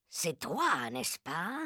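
low, very quiet threat; single out the culprit in a hushed, low voice. A narrator's reading. Narrator voices the Grand High Witch.

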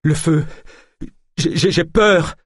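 high, stammering fearful cry; panicked, broken exclamations; terrified. A narrator's reading. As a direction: stutter in terror. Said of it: "d'un air terrorisé".

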